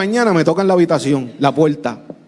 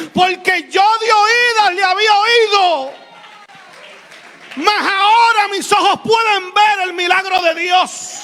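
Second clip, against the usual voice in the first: high, slow and shouted, like a revival preacher; preacher retelling a miracle to his church, slow fervent shouting; preaching at full force; 8 semitones higher than usual. Acted. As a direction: proclaim God's message to the congregation. Preaching the stranger's message.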